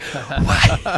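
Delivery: breathless what; stunned gasp. Retold or imitated. Hearing Crosby say her name, gasped in shock. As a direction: mouth the word in shock. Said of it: "I'm like".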